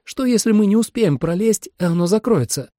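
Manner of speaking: high, anxious what-if; doubtful. Acted. Holly fears the gap will close, anxious doubt. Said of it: "усомнилась Холли".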